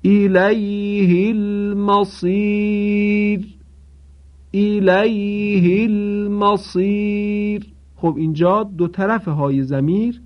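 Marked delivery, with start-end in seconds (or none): 0.0-3.5 الیه without lengthening the ha, slow chanted; Qur'anic recitation. Shown as a demonstration. 4.5-7.7 الیه without lengthening the ha, slow chanted; Qur'anic recitation. Shown as a demonstration.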